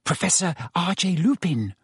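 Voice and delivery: put-on hushed, precise girl's reply; whispered. A narrator's reading. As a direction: whisper the answer promptly. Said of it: "whispered Hermione at once".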